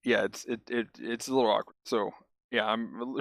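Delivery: slightly trembling, unsteady voice; shaky from low sugar. Real, not acted. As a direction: talk shakily, a bit rushed and quiet. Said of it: "like, shaking".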